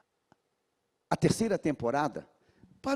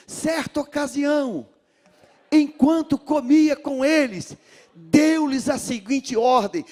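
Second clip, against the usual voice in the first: much higher and louder dramatic scripture reading; read with intonation; 10 semitones higher, 9 dB louder than usual. Shown as a demonstration. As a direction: proclaim the verse with full fervour. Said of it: "Você pode ler com entonação". (leitura bíblica com entonação, bem alta e forte)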